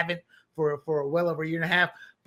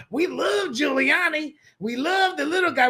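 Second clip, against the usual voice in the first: cheering residents; 7 semitones higher than usual. Retold or imitated. Residents cheering for their mayor.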